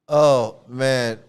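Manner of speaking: ordinary talk, nothing marked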